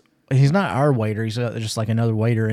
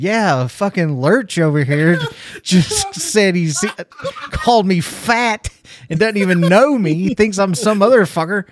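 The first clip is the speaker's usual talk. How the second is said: higher, comic outrage with laughs breaking in; retold indignant complaint; 7 semitones higher, 4 dB louder, slower than usual. Insulted diner to the manager, mock-indignant complaint.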